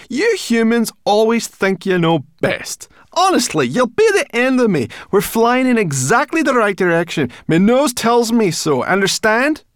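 high, loud, indignant tirade; spitting it out. A narrator's reading. Sorrel berating humans to Ben, spitting it out. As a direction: scold at full pitch, crossly. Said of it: "she spat".

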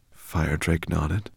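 usual speaking voice

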